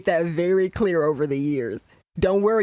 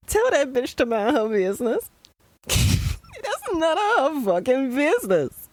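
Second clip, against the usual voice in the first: exasperated outburst breaking into a laugh, pitch up high; indignant outburst; 8 semitones higher than usual. Real, not acted. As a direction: tell the character off, half laughing. Angry at a character on screen.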